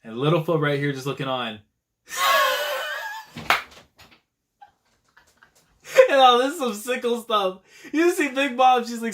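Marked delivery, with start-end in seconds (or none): 2.1-3.6 sudden high shrieking laugh. Real, not acted. Viewer cracking up at the fight, shrieking laugh. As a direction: burst out laughing at the screen.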